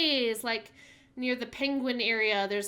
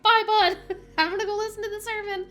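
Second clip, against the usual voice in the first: high, bright sing-song goodbye with a laugh; higher, faster mock-breezy farewell to the baby; cheery goodbye; 8 semitones higher, 6 dB louder, faster than usual. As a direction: chirp a carefree goodbye, laughing.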